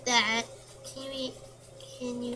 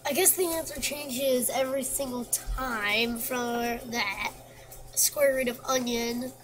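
normal talking voice

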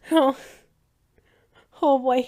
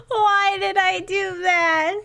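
anguished laugh; 6 semitones higher, slower than usual. Real, not acted. Regretting a scary choice.

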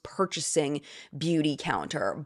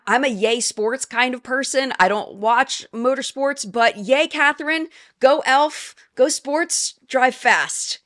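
higher, louder cheerleading; forced sports cheer; 6 semitones higher, 4 dB louder than usual. Pretend. Host playing a cheering sports fan on purpose, higher and louder. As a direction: cheer like a fan who doesn't watch. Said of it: "How'd I do?".

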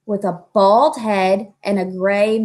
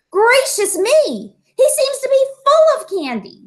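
shocked doctor; 4 dB louder than usual. Acted. Doctor alarmed at the candy.

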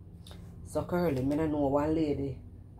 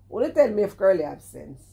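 indignant demand; 4 semitones higher, 8 dB louder than usual. Real, not acted. Indignant challenge.

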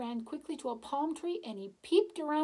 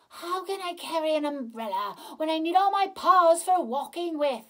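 higher, louder complaining tiger; grumbling tiger; 6 semitones higher, 9 dB louder than usual. Acted. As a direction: object like a fussy tiger. Tiger objects to the umbrella.

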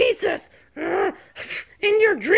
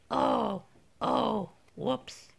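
sheepish; 7 semitones lower, 11 dB quieter, much slower than usual. Acted. Realises it was own fault.

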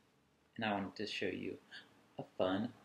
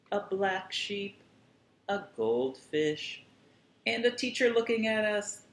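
higher, louder, building to a big finish; building excitement; 11 semitones higher, 7 dB louder than usual. Pretend. Host reading to kids, building excitedly to the last line. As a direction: build up to the grand finale. Climactic end of the list.

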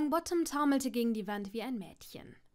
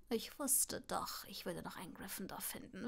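gloating whisper; 4 semitones lower, 10 dB quieter than usual. Acted. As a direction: savour the catch in a whisper. Malfoy gloats over caught Neville.

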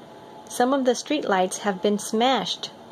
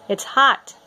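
warning call; 4 semitones higher, 5 dB louder than usual. Acted. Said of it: "he warns".

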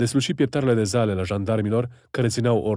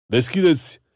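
"Deschideți!" barked high and loud, rising at the end; gendarme shouting; 6 semitones higher, 5 dB louder than usual. Acted. Gendarme at the gate ordering them open, "Deschideți!" shouted rising. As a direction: pound out "Deschideți!" as a rising command.

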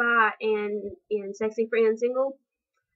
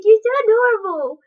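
louder, higher doting coo; cooing at a cutie; 8 semitones higher, 9 dB louder than usual. Retold or imitated. Fan cooing at her idol like a granny, mildly played. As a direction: gush like a granny pinching cheeks. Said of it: "poke his cheeks and be like".

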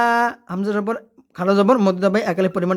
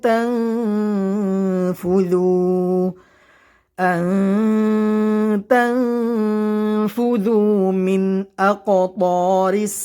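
slow, melodic Quran recitation; much slower than usual. Shown as a demonstration. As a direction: chant each word slowly with long vowels. Continuous recitation of verses.

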